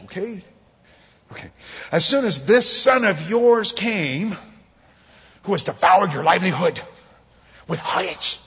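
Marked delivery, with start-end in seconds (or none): 1.9-8.4 indignant. Acted. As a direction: lay the grievance on thick. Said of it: "saying my brother".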